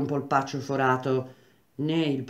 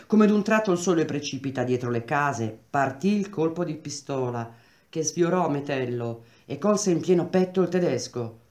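plain reading voice

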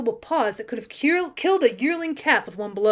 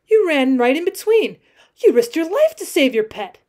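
louder, higher amazement; exclaiming; 4 semitones higher, 7 dB louder than usual. A narrator's reading. Girl marvels at cowboy's risk, exclaiming. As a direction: burst out in raised, amazed tones. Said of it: "she exclaimed".

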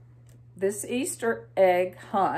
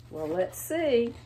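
plain reading voice

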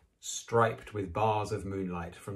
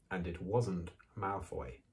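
ordinary delivery, nothing marked

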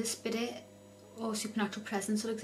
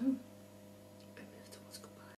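nervous night vlog whisper; 3 semitones higher, 11 dB quieter, much slower than usual. Real, not acted. Tense whisper at Ouija board.

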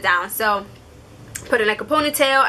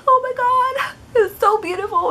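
much higher, sobbing parody of a crier; mock teary crier; 13 semitones higher than usual. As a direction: ham up a gushing weepy person. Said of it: "one of those people who are like".

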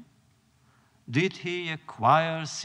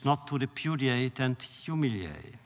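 ordinary talk

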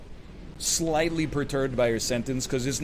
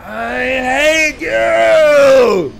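very long, high, loud howl; furious scream; 9 semitones higher, 12 dB louder, much slower than usual. Real, not acted. Streamer to the game that beat him, furious scream. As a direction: scream it at the enemy, stretching every word.

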